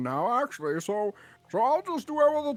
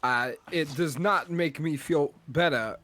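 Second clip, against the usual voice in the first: Christopher Walken; 11 semitones lower than usual. Pretend. Friend argues Gatorade colors as Christopher Walken. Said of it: "trying to go for, of a Christopher Walken".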